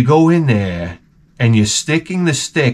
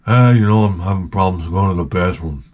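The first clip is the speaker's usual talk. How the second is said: Ron's voice; 6 semitones lower than usual. Retold or imitated. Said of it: "he would say to me, go".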